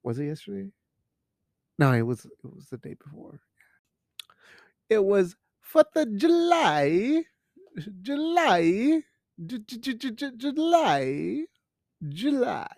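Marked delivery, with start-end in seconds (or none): 4.9-12.7 July stretched high and playful, J stuttered; sing-song July. Acted. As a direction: gioca con la parola July, acuta e cantilenante.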